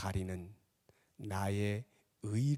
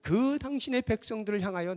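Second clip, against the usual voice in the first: loud, high-pitched sermon cry; full-force preaching; 14 semitones higher, 13 dB louder than usual. Acted. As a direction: preach it urgently at the top of your voice. (힘껏 외치는 설교)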